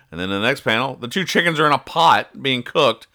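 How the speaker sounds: ordinary talk, nothing marked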